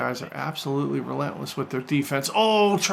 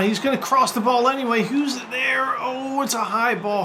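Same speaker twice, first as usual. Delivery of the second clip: rising excitement; 7 semitones higher than usual. Real, not acted. Live match commentary, cross incoming.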